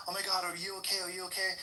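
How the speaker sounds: high, fussing mother voice; mock worried mom. His mom fretting over a lamp, high mock concern. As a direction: fuss over the lamp, not the boy. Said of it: "And my mom's like".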